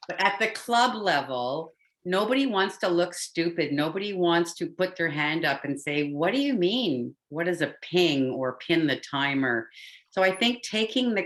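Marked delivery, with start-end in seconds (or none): none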